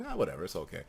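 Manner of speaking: low, soft breezy brush-off; mock calm. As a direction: play it cool and hide the anger. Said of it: "I'll still be like".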